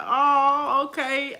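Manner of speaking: high, drawn-out oh, okay; acted-out reply. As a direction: play up a retold reply. Acting out own reply to friend.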